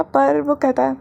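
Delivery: ordinary delivery, nothing marked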